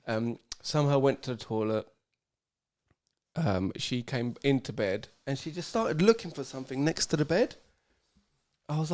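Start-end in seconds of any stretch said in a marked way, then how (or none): none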